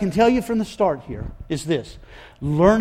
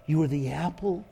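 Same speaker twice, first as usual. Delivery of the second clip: soft, near-whispered endearment; softer and lower, tenderly; tender voice of God; 4 semitones lower, 11 dB quieter than usual. Shown as a demonstration. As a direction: hush it like a father to a sleeping child. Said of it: "It is a language of affection".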